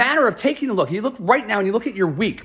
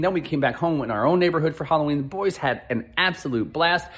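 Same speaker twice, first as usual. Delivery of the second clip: ordinary talk, nothing marked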